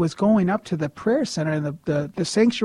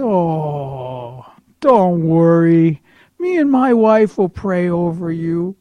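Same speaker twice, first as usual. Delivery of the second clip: Ed; 5 dB louder, much slower than usual. Retold or imitated. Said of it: "Ed's an older gentleman".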